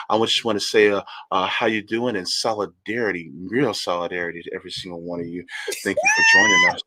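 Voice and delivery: mock late night R and B DJ welcoming listeners; late night R&B DJ impression. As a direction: croon it like a late-night radio host. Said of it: "that's how he sounded".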